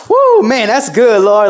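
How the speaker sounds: high, loud whooping praise; hollering praise alone at home; excited worship shout. Retold or imitated. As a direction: let it rip, shouting joy. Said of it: "I start like".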